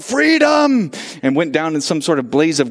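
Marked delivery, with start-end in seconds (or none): 0.0-0.9 Braveheart battle cry. Pretend. Preacher imagining storming the corporate offices, shouting. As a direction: belt it out like William Wallace charging. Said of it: "ran up to the, to the corporate offices and yelled".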